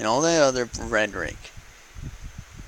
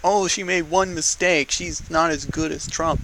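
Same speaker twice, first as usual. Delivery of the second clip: high, louder mock protest; mocking critics; 6 semitones higher, 4 dB louder than usual. Mimicking voters who excuse their candidate, mock protest. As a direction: imitate the complainers in a raised, louder voice. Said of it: "you guys are like".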